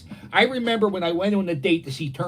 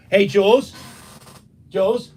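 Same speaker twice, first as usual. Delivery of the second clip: raised, questioning call; 4 semitones higher, 6 dB louder than usual. Real, not acted. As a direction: call for someone in another room. Calls to someone in the house.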